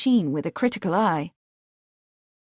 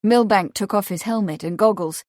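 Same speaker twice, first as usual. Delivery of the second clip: ordinary delivery, nothing marked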